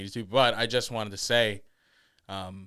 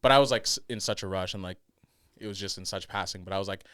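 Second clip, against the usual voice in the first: normal talking voice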